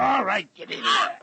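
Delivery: high, loud bark shoving the prisoner; angry shout. Acted. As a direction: yell at him as you push him forward. Shoving captive into light.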